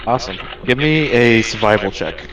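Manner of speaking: ordinary talk, nothing marked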